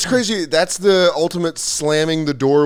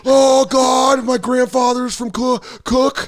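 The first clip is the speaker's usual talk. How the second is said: high, hammed-up Irish-American ecstasy; aroused Irish American; 9 semitones higher, 3 dB louder, slower than usual. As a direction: moan with mock pleasure about Irish roots. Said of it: "Irish Americans would be like".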